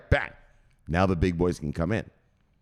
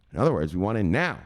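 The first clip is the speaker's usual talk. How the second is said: ordinary delivery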